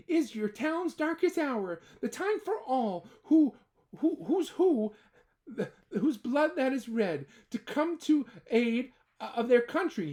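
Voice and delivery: rally the townsfolk, mildly stirring; crying out. Acted. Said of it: "cried the mayor".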